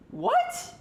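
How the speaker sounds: high, loud yelp of disbelief; shocked. Real, not acted. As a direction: yelp it out, stunned. Fan reacts to disputed catch.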